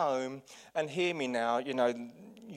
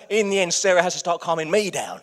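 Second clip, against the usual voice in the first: ordinary delivery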